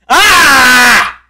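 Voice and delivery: short raging yell at the game; frustrated scream. Real, not acted. Gamer screaming at the game in frustration.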